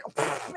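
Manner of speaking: soft unvoiced pfft; dismissive pfft. Husband scoffing at her despair, soft pfft. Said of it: "He was like".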